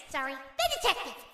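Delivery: ordinary delivery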